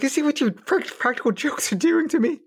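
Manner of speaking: high-pitched, exasperated whine; playfully indignant, pitched well up; mock upset complaint. Retold upset complaint to girlfriend.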